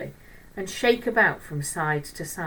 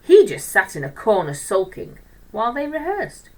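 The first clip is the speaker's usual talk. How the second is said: ordinary delivery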